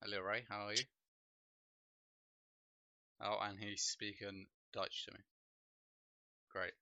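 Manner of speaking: ordinary talk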